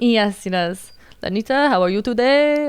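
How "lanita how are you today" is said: put-on light Turkish accent, raised higher; a friendly boss in a Turkish accent. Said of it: "he just has a very thick accent".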